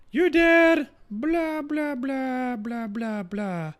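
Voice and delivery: very high, squeaky imitation of mom ranting; mocking mom voice. Streamer imitating his mom complaining about his dad, in a high mocking voice. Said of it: "She's like".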